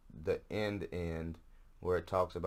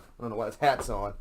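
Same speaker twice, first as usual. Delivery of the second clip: louder put-on complaining voice; mock exasperation; 3 semitones higher, 7 dB louder than usual. Host griping about his hat, louder mock complaint. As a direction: repeat it as a hammed-up gripe.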